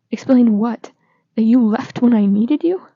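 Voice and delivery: loud hurt accusation; angry. Acted. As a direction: throw it back at him, wounded and furious. Nick confronts Charlie, hurt and furious.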